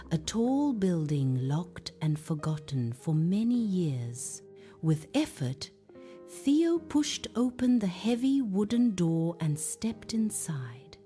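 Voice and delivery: usual speaking voice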